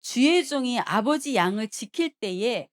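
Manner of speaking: loud, slow declaimed reading; scripture reading. Acted. Preacher leading the congregation through Samuel, loud scripture reading. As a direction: proclaim the verse with measured force. Reads the Bible passage aloud.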